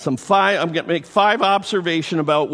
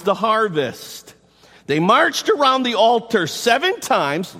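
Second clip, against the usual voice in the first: raised, declaiming reading voice; preacherly quotation; read-aloud quote; 5 semitones higher than usual. Acted.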